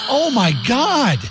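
high, drawn-out retold gasp of horror; shocked reaction. Dad hearing about the grandmother's ashes, shocked.

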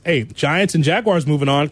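usual speaking voice

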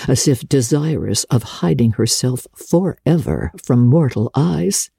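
ordinary delivery

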